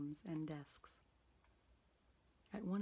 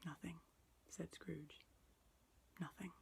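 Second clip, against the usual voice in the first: softer, curt evasive reply; quiet denial; 9 dB quieter than usual. A narrator's reading. Scrooge hiding his feelings from the spirit, quiet. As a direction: brush it off under the breath.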